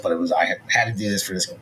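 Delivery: normal talking voice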